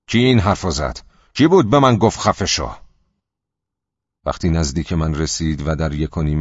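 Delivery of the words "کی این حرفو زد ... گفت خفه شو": louder and higher, building furious demand; angry shout. Acted. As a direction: yell it at the silent crowd, rising. Bully hunts the heckler, shouting.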